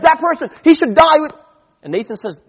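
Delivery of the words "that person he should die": loud, high, indignant shout; angry outburst. Retold or imitated. David furious at Nathan's story, indignant shout. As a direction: burst out in outrage at the thief.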